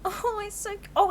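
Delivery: high delighted coo. Real, not acted. Player cooing over the possum, high and delighted. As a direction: melt over a cute animal.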